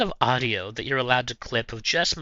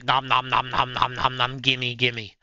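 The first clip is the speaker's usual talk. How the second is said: nom nom eating noise. Retold or imitated. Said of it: "I would be like".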